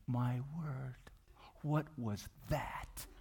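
hushed, slow stunned whisper; very soft, drawn-out amazement; awed whisper. Retold or imitated. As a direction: whisper it in disbelief to a friend. Peter's awed aside after the storm.

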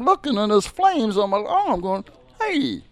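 high, casual mock alarm; Pryor on fire bit. Retelling Pryor's freebase bit, high and oddly casual. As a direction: squeak it up, calm about burning. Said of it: "just the way he did it".

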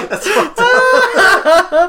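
squealed out, high and loud through laughter; laughing. Real, not acted. Friend cracks up at a crude joke, laughing. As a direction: crack up while saying it.

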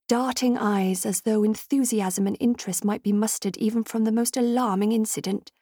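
ordinary delivery

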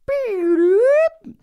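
piercing, very high and loud beep; a monster shrieking an alarm; creature screech. Acted. Grimace spotting Beast of Brusco, piercing screech.

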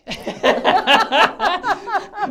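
loud, high burst of laughter; hearty laugh. Real, not acted. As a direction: crack up at your own remark. Laughing at his own slap remark.

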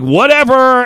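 passerby blowing off a man asking for help, high and loud; dismissive. Retold or imitated. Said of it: "I'm like".

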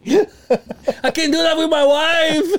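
laughing shout of protest. Real, not acted. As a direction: squeal it through laughter. Laughing at the joke.

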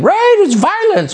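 high, drawn-out parody of a cable pundit; mocking sing-songy voice. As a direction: mimic her in a slow, high lilt. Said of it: "she'll be on cable news saying".

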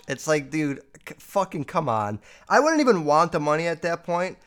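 usual speaking voice

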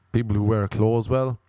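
ordinary talk, nothing marked

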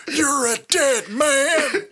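high, slow strained rasp; Terry Funk voice. Retold or imitated. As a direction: stretch it out in a cartoon prospector voice. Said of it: "talking like this".

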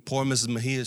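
usual speaking voice

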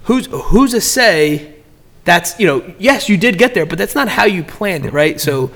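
ordinary talk, nothing marked

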